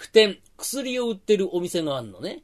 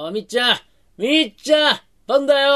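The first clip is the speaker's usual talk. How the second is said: loud, higher call to a hard-of-hearing friend; calling out loudly; 8 semitones higher, 7 dB louder than usual. Acted. Host calls out loudly to deaf Micchan. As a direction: shout it across the room. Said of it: "大きな声でって言ったでしょ".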